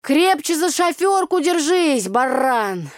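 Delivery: loud, high, slow mocking yell; mock shout. Tanya shouting at Tolik, mock loud. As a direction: yell it at the drunk driver. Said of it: "Ну всё, думаю".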